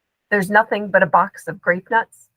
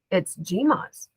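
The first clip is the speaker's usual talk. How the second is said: ordinary delivery, nothing marked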